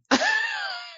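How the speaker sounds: very high burst of laughter; squealing laugh. Real, not acted. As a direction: shriek with delight. Laughing at dad's reaction.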